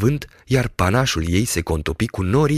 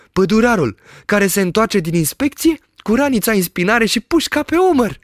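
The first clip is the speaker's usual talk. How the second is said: high, lively shepherd voice, delighted; excited shepherd; 14 semitones higher, 4 dB louder than usual. A narrator's reading. Shepherd describes the village he sees, high and thrilled. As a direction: rattle off the sights in wonder.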